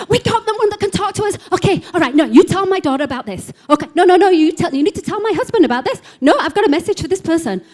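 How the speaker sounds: high, loud, overlapping demands; pushy crowd of voices all butting in; clamouring spirits. Retold or imitated. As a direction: jostle to be heard, urgent and high-pitched. Imitates clamouring spirit voices at night.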